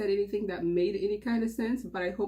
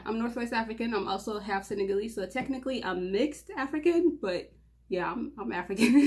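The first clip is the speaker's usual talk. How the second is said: ordinary talk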